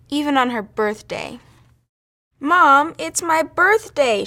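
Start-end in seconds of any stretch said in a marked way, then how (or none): 2.4-4.2 put-on high, loud, drawn-out child's whine; slow whiny complaint; whining. A narrator's reading. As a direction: whine at mom, stretching the words. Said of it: "she whined".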